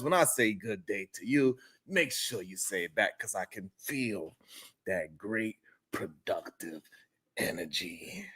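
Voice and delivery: dropping to a near whisper; hushed. Real, not acted. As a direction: lean in and hush the last words. Hushed intimate sign-off to chat.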